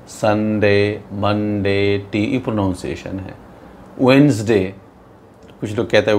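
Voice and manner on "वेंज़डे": "वेडनेसडे" said as WENZ-day, no D sounded; silent-D pronunciation. Shown as a demonstration. Teacher models the correct day name, "वेडनेसडे" as wenz-day. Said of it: "डी बिल्कुल silent होता है".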